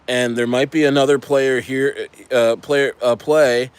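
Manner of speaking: ordinary delivery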